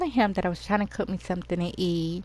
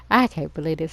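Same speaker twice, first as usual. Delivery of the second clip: ordinary talk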